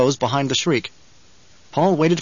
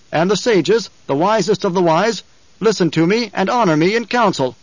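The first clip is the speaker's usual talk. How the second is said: higher, projected rhetorical address; commanding oratory; 5 semitones higher, 4 dB louder than usual. A narrator's reading. Paul arguing to the throng over who rules, commanding. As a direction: press the argument on the crowd. Paul orates to the silent crowd.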